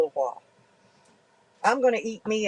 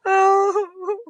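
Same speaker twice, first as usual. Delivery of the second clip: laughing; 11 semitones higher, 4 dB louder than usual. Real, not acted. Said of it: "Cry bully hell".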